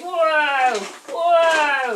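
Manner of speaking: loud high whoa, whoa; mock alarm. Storyteller for kids acting the tossing boat, loud mock alarm. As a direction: act the boat tossing in the storm.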